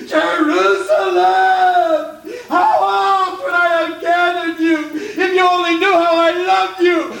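preacher acting Jesus sobbing aloud; wailing. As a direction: cry out the city's name in grief. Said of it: "he wailed".

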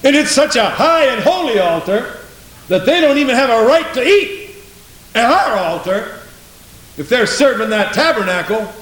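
high, proclaiming rebuttal in the author's voice; ringing preacher's retort; emphatic interruption. Acted. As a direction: break in and declare it proudly. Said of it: "interrupts their thinking and says".

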